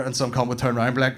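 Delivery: ordinary talk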